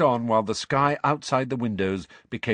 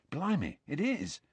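softer, half under the breath; quietly; 6 semitones higher, 7 dB quieter than usual. A narrator's reading. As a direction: drop to a hushed, awed voice. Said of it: "said Ron quietly".

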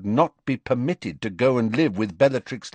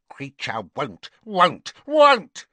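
building elf shriek; 7 semitones higher, 6 dB louder, slower than usual. A narrator's reading. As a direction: croak that climbs into a high, loud shriek. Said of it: "croaked the house elf".

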